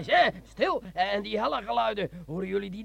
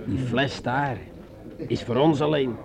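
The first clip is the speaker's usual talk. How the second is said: much lower, sly and intimate; low seductive sergeant; 10 semitones lower than usual. Acted. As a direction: coax her into drinking, low and sly. Sergeant speaks low to Margot. (sergeant fluistert laag en verleidelijk tegen Margot)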